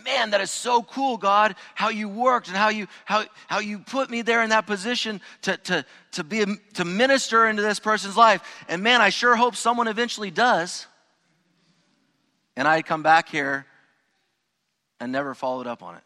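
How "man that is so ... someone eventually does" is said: high, loud, slowed gushing enthusiasm; sarcastic imitation of a pious do-nothing; mock gushing. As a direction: ham up the empty praise of a bystander. Mocking the do-nothing reaction.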